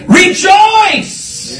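a high ringing cry of rejoicing; rejoice shout. Real, not acted. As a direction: burst out with it in praise. Preacher exclaims scripture command.

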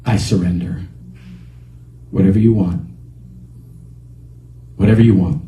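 low, subdued yielding; humble surrender. Retold or imitated. Man coming home to Jesus, low surrender. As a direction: give in completely, pitched low. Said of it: "And he said".

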